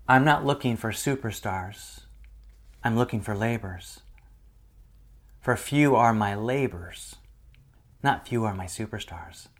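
hushed divine voice; quiet voice of God. Retold or imitated. Voice of the Lord retold softly.

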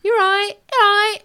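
high, loud Leeds greeting; fluting mock Leeds accent, pitched right up; high, fluty voice. Greeting locals the way they do, high fluty Leeds accent. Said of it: "Very high, fluty voice".

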